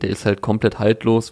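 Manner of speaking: ordinary talk, nothing marked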